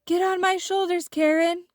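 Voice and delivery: Frosty urging Karen to flee the magician; urgent cry. A narrator's reading. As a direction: hurry her up to escape. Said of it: "cried Frosty".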